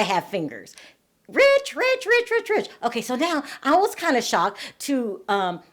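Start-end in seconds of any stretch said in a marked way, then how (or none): 1.3-2.7 high, sing-song mock of a gushing friend; mocking Lisa Rinna. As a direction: parrot her gushing, pitched way up. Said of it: "Lisa Rinna probably said it more than".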